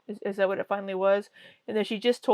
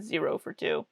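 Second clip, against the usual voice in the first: ordinary talk, nothing marked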